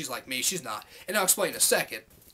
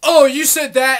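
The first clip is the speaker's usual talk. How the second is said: loud mock whine of a clueless viewer; mocking outburst; 4 semitones higher, 11 dB louder, slower than usual. YouTuber mocking viewers who get pissed off, loud mock whine. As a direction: blurt it out like an indignant commenter.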